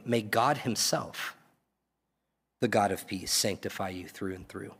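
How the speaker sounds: slow, measured scripture reading with long pauses. Shown as a demonstration. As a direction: read it slowly, pausing between phrases. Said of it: "Let me read that again slowly".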